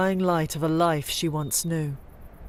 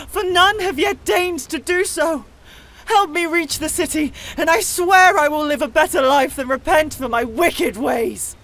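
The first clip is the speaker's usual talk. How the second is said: high, loud pleading cry; a desperate woman crying out for salvation; 12 semitones higher, 7 dB louder than usual. Acted. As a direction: beg for rescue at the top of your voice. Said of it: "Savala cried out".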